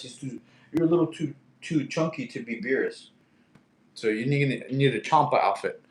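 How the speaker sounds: usual speaking voice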